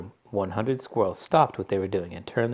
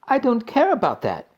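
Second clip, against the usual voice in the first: high and loud, stamping her foot; bratty shout; 14 semitones higher, 7 dB louder than usual. A narrator's reading. Spoiled Veruca refuses her mother, shouting. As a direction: whine it at full volume. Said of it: "shouted Veruca".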